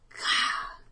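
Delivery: sharp breathy gasp of fright; startled gasp. Acted. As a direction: gasp as the phone jolts her. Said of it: "gasped Clara Peck".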